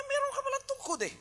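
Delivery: a playful divine voice, lifted high; God's reply. Acted. God answers Moses in story.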